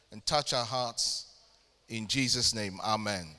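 bowing in prayer to close the service. Acted. Opening prayer.

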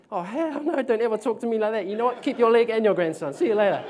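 high, loud pretend huff at her; playfully fake-offended telling her off; mock outrage. Preacher hamming up an offended reply to the woman. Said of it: "I'm just playing".

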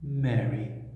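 low, soft and tender; loving and concerned. A narrator's reading. Jesus to Mary, tenderly saying her name. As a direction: say her name gently, full of love. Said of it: "filled with love and concern".

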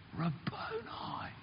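breathless, slow whisper of disbelief; astonished whisper. Retold or imitated. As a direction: whisper it as if you can't believe your eyes. Mary recognises the risen Jesus.

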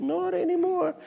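high falling sigh on not anymore; mock mournful not anymore; wistful not anymore. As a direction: sing it out, sadly high then dropping. Repeated mock lament.

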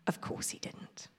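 whispered storyteller aside. Acted. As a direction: whisper the answer to the kids.

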